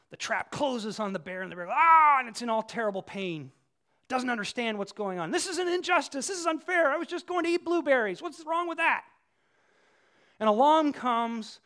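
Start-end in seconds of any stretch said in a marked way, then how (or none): 1.7-2.2 loud, raised "Ah!" of pain; mock bear cry of pain. Bear caught in trap cries out. 5.3-9.0 high-pitched, aggrieved protest; mock indignant bear. Voices the trapped bear protesting injustice.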